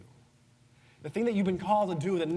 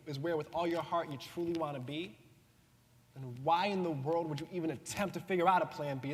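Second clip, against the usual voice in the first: normal talking voice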